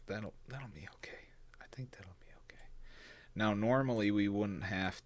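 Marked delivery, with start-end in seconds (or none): 0.1-2.6 whispered. Real, not acted. Muttering to himself.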